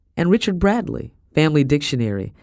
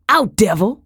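high, loud command; fierce raised-voice order; shout; 6 semitones higher, 5 dB louder than usual. A narrator's reading. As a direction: shout to drive out a devil. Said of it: "Madam shouted".

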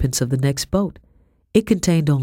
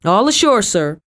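loud soldier's call, pitched high; 6 semitones higher, 5 dB louder than usual. A narrator's reading. Soldier calling to his captain from the boat, loud. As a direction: shout it across the water.

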